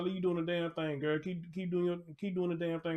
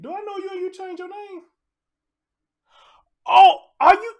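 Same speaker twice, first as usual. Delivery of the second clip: high-pitched, exclaimed double take; surprised recognition; 16 dB louder than usual. Real, not acted. Streamer spotting a renamed viewer in chat, surprised recognition. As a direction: blurt it out high, getting much louder. Puzzled by a changed name.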